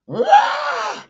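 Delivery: a high, loud roar as she charges; bull-like roar. Retold or imitated.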